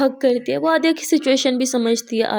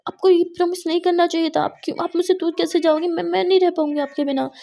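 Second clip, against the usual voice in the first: angry protest; 6 semitones higher than usual. Acted. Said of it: "मुस्कान गुस्सा करने लगती है".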